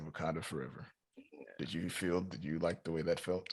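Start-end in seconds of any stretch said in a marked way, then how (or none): 0.0-0.9 limp, quiet and unenthused; flat reluctant delivery. Retold or imitated. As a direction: say it like you don't want to. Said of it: "listen to the way he said".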